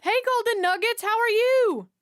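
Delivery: high, loud call to a pet; calling out sweetly. Real, not acted. Calling out to the cat.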